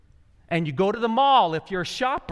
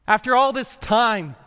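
loud, higher, at long last; exclaimed relief; 5 semitones higher, 7 dB louder than usual. Acted. God to you after ten years of waiting, exclaimed. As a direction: exclaim it like a long wait just ended.

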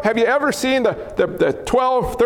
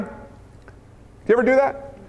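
normal talking voice

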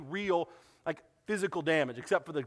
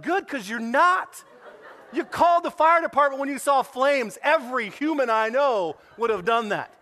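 louder, higher and slow, teasing indignation; mock indignant retort; 8 semitones higher, 8 dB louder than usual. Pastor to the security guard, teasing indignant retort. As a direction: put him in his place with a grin.

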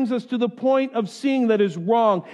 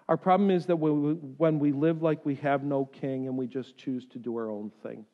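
normal talking voice

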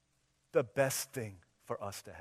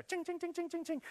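high, light ching-ching; cash register ching. Retold or imitated. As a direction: mimic the register's ring. Cash register sound effect.

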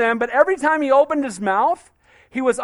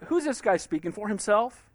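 ordinary talk, nothing marked

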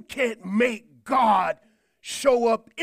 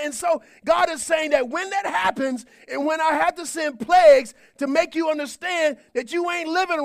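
high, loud, driving sermon voice; full-force preaching; 7 semitones higher, 4 dB louder than usual. Real, not acted. Preacher warning the church it will forsake God, preaching at full force. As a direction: preach it hard to the back row.

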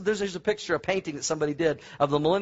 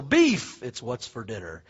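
commercial announcer imitation; 8 semitones lower, slower than usual. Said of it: "discontinue the commercial".

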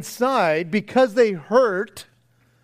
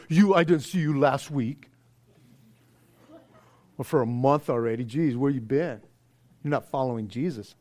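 mock scolding; 7 semitones lower, 4 dB quieter than usual. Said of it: "I'm not here just to go".

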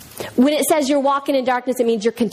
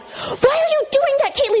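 very high mock outburst; high-pitched retold child's tantrum; mad little brother; 14 semitones higher than usual. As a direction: imitate a small boy mad at his sister. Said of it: "he got so mad at his sister".

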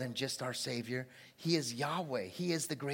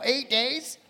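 normal talking voice

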